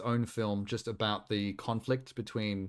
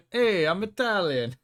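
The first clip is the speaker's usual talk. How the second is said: hammed-up Italian accent, slow and high; mock Italian; 8 semitones higher, 4 dB louder, slower than usual. Streamer mocking the movie, hammed-up Italian accent. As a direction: play a cartoon Italian announcing himself. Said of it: "so we know that he's Italian".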